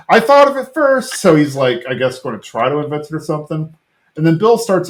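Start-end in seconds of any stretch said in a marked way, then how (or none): 0.1-1.1 much higher, a bit louder burst; host voicing the neighbour claiming an idea, pitched way up; mock excited Dale. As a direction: blurt it out like a sudden eureka. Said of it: "Dale's like".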